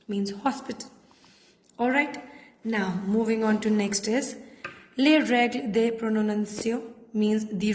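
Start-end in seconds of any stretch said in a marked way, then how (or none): none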